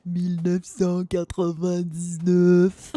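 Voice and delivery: loud, slow fake wail; hammed-up crying out the French year; mock crying. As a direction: bawl it out in pretend despair, dragged out. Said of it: "somebody else just crying".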